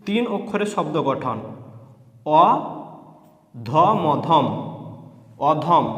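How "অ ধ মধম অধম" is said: spelled reading drill. Shown as a demonstration.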